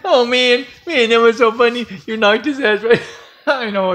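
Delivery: helpless high-pitched cracking up; real laughing. Real, not acted. Storyteller laughs at knocking him off the bed, cracking up. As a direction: talk through your laughter. Cracking up after the jump scare.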